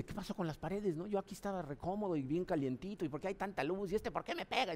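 high, soft mock baby voice, bewildered; frightened newborn. Preacher voicing a newborn scared of the light, soft, high, bewildered baby voice.

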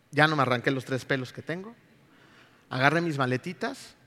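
ordinary talk, nothing marked

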